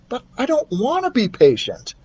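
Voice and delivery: put-on high stubborn boy; boy refusing to wait; defiant boy. Keith voiced.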